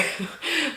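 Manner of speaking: a quick high burst of laughter; bright laugh. Real, not acted. Laughing as she tells viewers her taxes came through.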